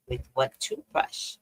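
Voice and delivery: slow, hushed question; quiet. Retold or imitated. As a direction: hold back, keep it soft. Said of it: "I can't yell".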